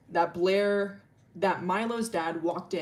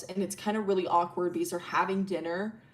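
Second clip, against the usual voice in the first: usual speaking voice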